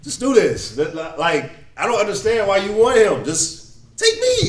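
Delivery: high-pitched, begging Judah; desperate pleading. Acted. Judah begs the stern man to take him instead, pleading.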